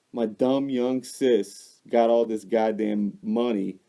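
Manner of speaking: usual speaking voice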